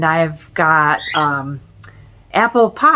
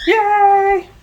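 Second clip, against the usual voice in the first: excited cheer; 14 semitones higher than usual. Real, not acted. Helper brings the maple syrup.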